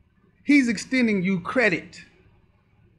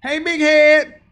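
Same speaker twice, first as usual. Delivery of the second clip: slow, loud, higher sing-song greeting; mock flirty call; 6 semitones higher, 10 dB louder, slower than usual. Podcaster imitating women calling to men, loud and sing-song. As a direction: stretch it out like a sweet come-on.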